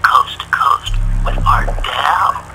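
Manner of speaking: very low, breathy late-night radio announcer; low radio voice with a dry, disgruntled edge. Acted. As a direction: intone it deep and hushed, like a sleepy late-night host. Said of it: "my perfect low radio voice".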